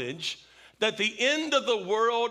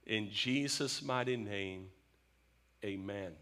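prayer; 4 semitones lower than usual. Acted. Pastor praying over the congregation, hushed and reverent. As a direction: pray humbly, letting each phrase settle.

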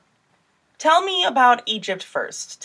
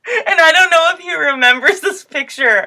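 laughing; 5 semitones higher, 7 dB louder than usual. Real, not acted. Laughing through funny memory.

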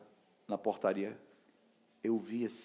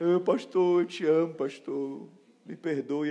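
tearful plea; 6 semitones higher, 7 dB louder, slower than usual. Retold or imitated. Tearful plea to old pastor.

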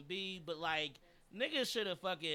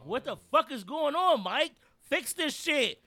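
angry; 8 semitones higher, 10 dB louder than usual. Real, not acted. Ranting at the coach.

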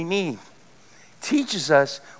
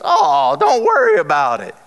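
high, loud voice waving off an apology; breezy forgiving lady; 5 semitones higher, 5 dB louder than usual. Retold or imitated. Lady at the restaurant forgiving his mistake, breezily dismissive. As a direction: imitate her with a big swooping "Oh".

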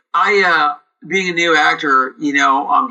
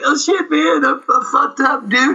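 put-on drunk Bill; 12 semitones higher than usual. Bill drunk telling his co-star he's wasted, high and sloppy. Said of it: "He's like".

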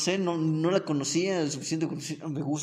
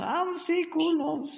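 put-on high girlish voice, higher at first; girly falsetto; 13 semitones higher than usual. Storyteller voicing a girl he chatted with, high and girlish. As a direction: play the girl chatting about books.

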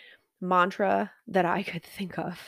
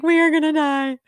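higher, louder hammed-up dread; mock doom wail; 8 semitones higher, 6 dB louder than usual. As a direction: wail it like a joking doomed hiker. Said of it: "I would have been like".

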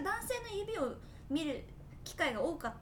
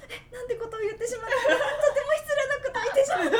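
much higher, louder, laughing self-scold; mock inner panic; 11 semitones higher, 10 dB louder than usual. Remembering a rude remark to a friend, laughing mock horror. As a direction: ham up the horror at your own blunder. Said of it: "と思って".

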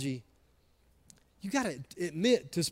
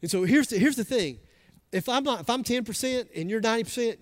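usual speaking voice

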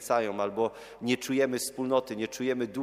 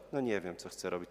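low, soft imitated shrug of an answer; unsure reply; 6 semitones lower, 8 dB quieter than usual. Young visitor answers priest's question, low and unsure. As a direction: drop the voice as someone with no idea.

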